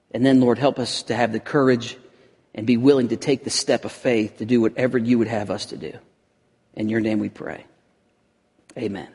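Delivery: hushed closing prayer. Acted. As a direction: pray it quietly and humbly. Opening prayer.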